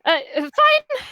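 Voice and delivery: high nervous squeak; a startled little squeak. Acted. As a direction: squeak it out, flustered. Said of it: "she squeaked a little".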